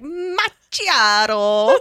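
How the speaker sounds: drawn-out, slow, hammed-up Italian "Macchiato"; mock Italian. Joking that he'd serve coffee, a hammed-up Italian "Macchiato". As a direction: stretch "Macchiato" like an Italian barista. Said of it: "He could be like".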